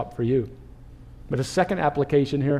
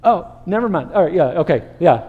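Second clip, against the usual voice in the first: much higher startled hey, then sheepish okay; caught-out backpedal; 4 semitones higher, 5 dB louder than usual. Retold or imitated. Crowd caught shushing him, flustered backpedal. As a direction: stop mid-scold and backtrack awkwardly. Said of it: "they're, like".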